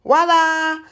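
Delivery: high, loud showman's voila; grand reveal. Real, not acted. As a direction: unveil it like a magic trick. Revealing the flattened table.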